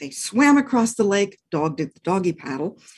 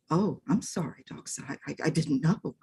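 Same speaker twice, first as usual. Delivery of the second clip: soft stammering apology; meek puppy sorry; sorry little dog; 3 semitones lower, 8 dB quieter than usual. Acted. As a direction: apologise meekly.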